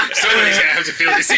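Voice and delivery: laughing quote. Retold or imitated. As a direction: squeal the line out through laughter. Dad quote continues, laughing.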